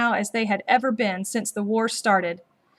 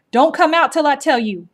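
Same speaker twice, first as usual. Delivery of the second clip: louder, raised command; firm urgent order; 5 semitones higher, 7 dB louder than usual. A narrator's reading. Old woman ordering the children to stay hidden, firm loud command.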